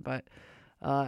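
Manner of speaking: ordinary talk, nothing marked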